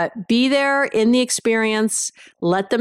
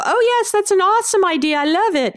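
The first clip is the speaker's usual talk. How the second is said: mock effusive praise; 11 semitones higher than usual. To partner about their idea, hammed-up effusive agreement. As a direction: overdo the delight like a yes-man. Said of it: "be effusive and go".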